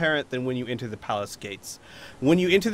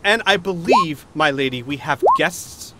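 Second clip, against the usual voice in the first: Fiddle; 3 semitones higher, 7 dB louder than usual. Acted. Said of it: "very nervous, high-pitched voice".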